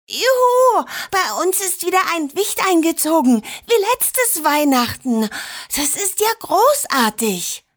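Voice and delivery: viel höher und lauter, jubelnd; begeisterter Jubel. A narrator's reading. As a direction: platz vor Freude heraus. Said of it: "Marinette schnappte nach Luft". (jüngere Schwester jubelt über den neuen Wicht, hoch und laut)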